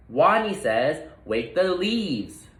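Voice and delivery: slow, emphatic repeat of the order; a little leader insisting; stronger voice. Acted. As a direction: say it again, firm and clear. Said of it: "Wadney's voice was stronger".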